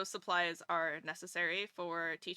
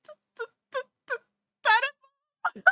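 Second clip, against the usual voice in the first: put-on stammering kid; 10 dB louder, much faster than usual. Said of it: "I'd be like".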